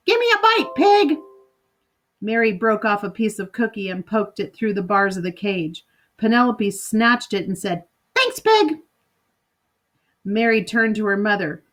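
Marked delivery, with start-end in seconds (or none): 0.1-1.2 much higher, squawky bird voice snapping an insult; a cross parrot demanding food, rude and shrill; high cartoon parrot, rude and bossy. 8.1-8.8 much higher squawky bird voice, mocking thanks; cheeky parrot with a sneer in it; rude parrot. Parrot snatches cookie.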